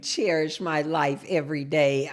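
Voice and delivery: low, slow, savoring repeat; relishing each word, low and unhurried. Real, not acted. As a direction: drop the pitch and stretch it out. Repeats the username after exclaiming.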